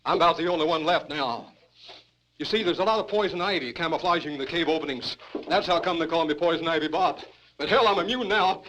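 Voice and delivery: usual speaking voice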